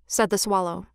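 usual speaking voice

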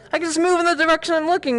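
high, fast excited discovery; quick, high-pitched burst of delight; delighted realisation. Real, not acted. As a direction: blurt it out fast and high, thrilled. Delighted realisation after the question.

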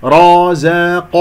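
slow رازق with full ر and ق, light ز between; heavy ra, qaf. Shown as a demonstration. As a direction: chant رازق, contrasting heavy and thin letters. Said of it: "الراء والقاف مفخمة". (تفخيم الراء والقاف في رازق)

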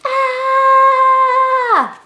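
sudden scared high shout of ah. Shown as a demonstration. Teacher demonstrating the scared letter sound, a sudden high yell. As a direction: jump back scared and yell ah. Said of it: "I would go".

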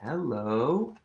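ordinary delivery

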